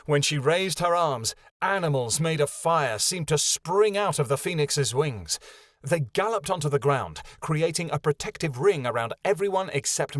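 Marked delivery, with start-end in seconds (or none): none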